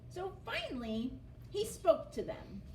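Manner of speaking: ordinary delivery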